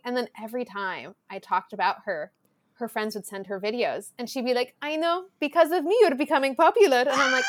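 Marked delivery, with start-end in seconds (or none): 4.8-7.0 smug mother. Retold or imitated. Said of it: "I need your ego".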